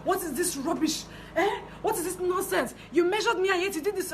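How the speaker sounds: high-pitched mock scolding, half laughing; retold shouting. Client berating tailor over the trousers, high mock scold. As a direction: imitate the client's outraged rant, pitched well up. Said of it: "shouting at the top of her voice".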